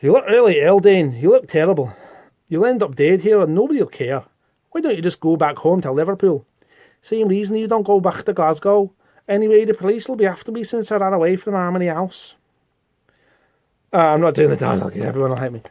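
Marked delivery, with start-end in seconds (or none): 0.0-1.9 Scottish accent. Shown as a demonstration. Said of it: "so I've got to do a Scottish accent". 2.5-12.3 comic-book line in a Scottish accent. Shown as a demonstration. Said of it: "so I've got to do a Scottish accent".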